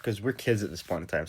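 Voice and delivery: plain reading voice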